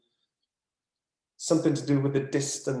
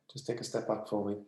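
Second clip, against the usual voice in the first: soft request; 3 semitones lower, 8 dB quieter than usual. Real, not acted.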